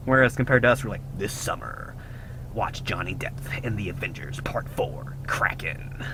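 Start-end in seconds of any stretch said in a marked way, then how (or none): 1.2-6.1 low, hushed trailer narrator; whispered epic trailer announcement; movie trailer voice. Pretend. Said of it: "the movie guy voice".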